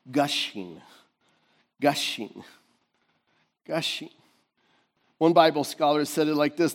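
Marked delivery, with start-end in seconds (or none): 0.1-4.1 soft repetition. Acted. Preacher repeats word softly.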